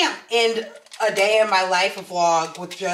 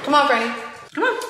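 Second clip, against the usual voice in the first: raised, coaxing call to Franny; calling the dog; 6 semitones higher, faster than usual. Real, not acted. Owner coaxing her dog to follow, raised call. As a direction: call the dog over, bright and higher.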